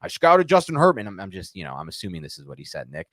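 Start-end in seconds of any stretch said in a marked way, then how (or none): none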